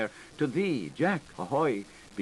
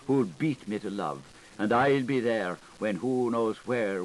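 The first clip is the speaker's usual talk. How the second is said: plain reading voice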